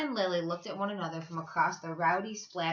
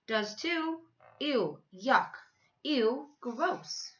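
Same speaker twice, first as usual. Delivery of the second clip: taunting ew; 5 semitones higher, 3 dB louder, much slower than usual. A narrator's reading. Kids quarrelling over sandwiches.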